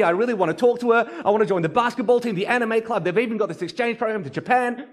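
put-on high, fast, breathless kid rushing through his day; excited young boy, talking a mile a minute; excited child. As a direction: gush it all out like a boy home from school. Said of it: "He's so excited".

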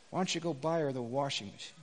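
low, soft, gentle question; soft voice of God. Acted. God gently prompting the divorced man, low and soft. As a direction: ask it softly, almost tender. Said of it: "this is what God said to him".

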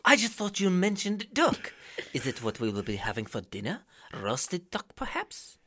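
stagey polite dinner guest, lilting; Mister Burnham character. Acted. Burnham asking Meredith about duck dinner, theatrical and polite.

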